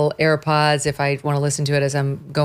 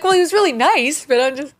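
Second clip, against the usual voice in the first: higher, sheepish mock husband voice; imitating Doug; 11 semitones higher than usual. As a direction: play the husband shrugging it off, higher. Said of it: "He's like".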